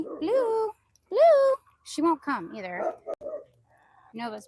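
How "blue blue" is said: loud, high hollered name; insistent calling. Real, not acted. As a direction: call a pet that won't come. Calling hen who won't come.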